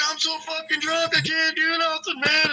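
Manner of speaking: put-on high-pitched drunken whine; imitated drunk. Drunk neighbour stuck under his canoe, high whining imitation. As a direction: mimic a helpless drunk complaining. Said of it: "he's so drunk".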